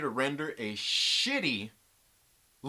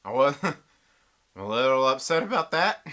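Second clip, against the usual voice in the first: laughing; 3 semitones lower, 5 dB louder than usual. Real, not acted. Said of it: "In case you can't tell".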